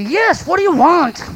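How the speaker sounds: high-pitched, exasperated demand; irritated. Retold or imitated. Preacher acts out resident answering insistent delivery person, irritated. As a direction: answer the door thoroughly put out, pitch jumping up.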